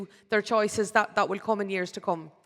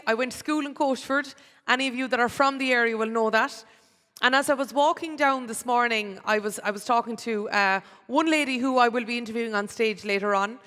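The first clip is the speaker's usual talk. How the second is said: normal talking voice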